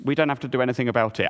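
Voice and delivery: tossed off fast, almost a joke; quick throwaway. Shown as a demonstration. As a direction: rush it out like a teaser. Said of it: "I'm gonna qualify that in a moment".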